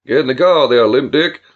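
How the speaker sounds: loud barked order, projected wide; megaphone call. A narrator's reading. Billy ordering his cousin to the car, loud megaphone call. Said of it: "brought the megaphone to his lips".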